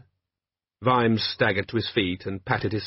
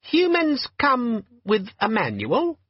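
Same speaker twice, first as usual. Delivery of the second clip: much higher squeaky chipper little voice; squeaky friendly demon; 13 semitones higher, slower than usual. A narrator's reading. Tiny demon answers Vimes about the time, squeaky and friendly. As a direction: sound annoyingly friendly. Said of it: "it said".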